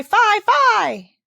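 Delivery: high, loud jeering "Fie, fie"; mocking cry. A narrator's reading. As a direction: sing out the taunt like a mocking crowd. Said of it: "they'll point at you and cry".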